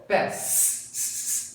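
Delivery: "Pass" with the final S drawn out and repeated; unvoiced ending. Shown as a demonstration. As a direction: let the S of "Pass" hiss on without voice. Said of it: "Não tem voz". ("Pass" com o S final alongado, sem voz)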